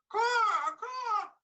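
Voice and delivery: high, loud crow cawing; bird caw. Acted. Blackbird calls to the young man, loud high caws.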